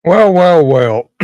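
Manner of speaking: booming knowing well well well; loud. Real, not acted. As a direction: make a big entrance. Opening line after intro.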